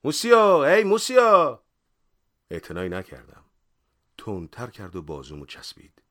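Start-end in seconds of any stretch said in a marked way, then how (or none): 0.0-1.6 very loud, high, drawn-out hail; shouted call. Acted. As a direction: holler to stop someone walking off. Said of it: "دوباره داد زد". (صدا زدن با فریاد کشیده)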